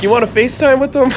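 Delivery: fast and high, bursting with the idea; eager offer. Retold or imitated. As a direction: pitch it as a thrilling surprise. Tim offers call with Griffey.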